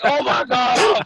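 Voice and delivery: high, loud, slow mock gasp of shock; mortified buddy. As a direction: act out his embarrassment. Embarrassed guy reacts.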